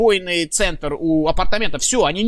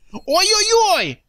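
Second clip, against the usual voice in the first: excited; 13 semitones higher, 5 dB louder, much slower than usual. Real, not acted. Caster reacts to clutch.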